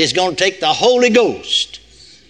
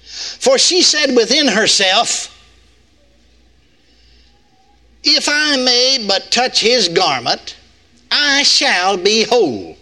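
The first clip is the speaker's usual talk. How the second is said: scripture reading; 7 semitones higher, 3 dB louder than usual. Acted. Preacher reads scripture aloud.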